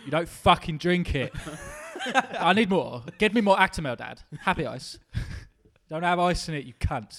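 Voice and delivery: loud whiny spoiled brat voice; Macaulay Culkin impression. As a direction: demand it like a spoiled clubkid. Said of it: "you sound like Macaulay Culkin from Party Monster then".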